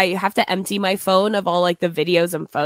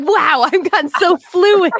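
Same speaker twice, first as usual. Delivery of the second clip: mock pride; 9 semitones higher, 7 dB louder than usual. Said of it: "You're like".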